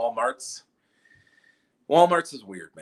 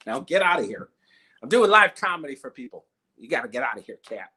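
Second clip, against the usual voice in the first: scolding; 3 semitones higher, 6 dB louder than usual. Real, not acted. Scolding cat off stage.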